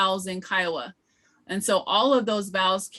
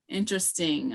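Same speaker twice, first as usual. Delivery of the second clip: ordinary talk, nothing marked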